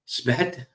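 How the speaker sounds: "sbet" said as one word, rising; "sbet" as a single syllable. Shown as a demonstration. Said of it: "or as I like to call it".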